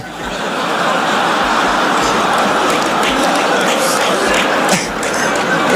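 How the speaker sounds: long audience laugh; crowd laughter. Real, not acted. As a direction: room roars laughing.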